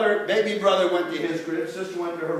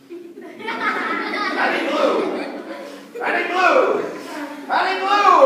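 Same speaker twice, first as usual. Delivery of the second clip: each repeat higher and louder; huffing harder with every try; building blowing effort; 12 semitones higher, 4 dB louder, much slower than usual. Acted. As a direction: build to a big, drawn-out blow. Storyteller acts out blowing, escalating.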